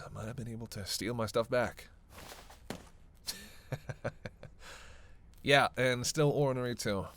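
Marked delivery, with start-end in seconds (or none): 3.3-5.1 quiet, amused little laugh; soft chuckle. Acted. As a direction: chuckle lightly to yourself. Amused by companion's attempt.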